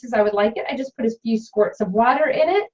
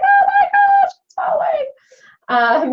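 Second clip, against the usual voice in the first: much higher, loud surprised cry; 5 dB louder, much slower than usual. Real, not acted. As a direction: squeal it out in surprise. Paste dripping off the stick.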